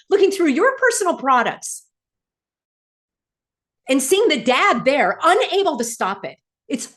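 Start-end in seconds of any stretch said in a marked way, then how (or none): 0.1-1.9 high, indignant outburst, hitting YOUR; real upset, voice climbing. Real, not acted. Woman recalling a search of her bag, upset. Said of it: "Yes, I'm getting upset".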